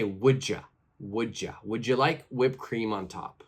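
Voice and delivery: "Would ya" blended as "wouldja", then the whole question joined; combined. Shown as a demonstration. Teacher giving learners a line to copy, joined. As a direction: glide "would you" into one. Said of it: "try to combine it and say".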